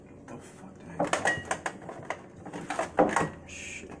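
soft, distracted muttering; real off-hand grumbling under the breath; talking to himself. Real, not acted. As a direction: mumble to yourself while hunting for something. Talks to himself hunting a key.

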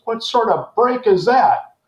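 high, drawn-out baffled question; incredulous colonel. Retold or imitated. Air Force colonel questioning the odd formation, incredulous. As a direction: act out a colonel's slow disbelief.

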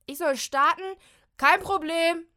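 high, very loud call; cheerful shout. Acted. Ruft missverstanden vom Dachboden. (laut fröhlich rufen)

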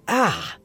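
cry of pain. A narrator's reading. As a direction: cut yourself off with a pained cry. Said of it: "I cried out in pain".

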